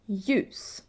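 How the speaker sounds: Ljus starting with a Y sound; silent L. Shown as a demonstration. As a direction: say Ljus as "yoos". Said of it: "you ignore the L".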